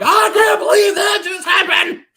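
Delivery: very high, loud, drawn-out scream; reenacted scream. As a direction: scream it out in stunned joy, reenacting the moment. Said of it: "scream like".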